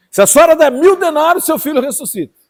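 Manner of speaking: high, loud huckster pitch; loud, high mock sales pitch for a miracle; mock greedy Jesus. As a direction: ham up a money-grabbing preacher. Mocking greedy preacher demanding.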